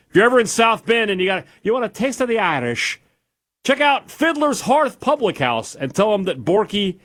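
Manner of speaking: lilting Irish pitch for a pub plug; high mock Irish brogue; Irish accent. Irish ad voice for the restaurant.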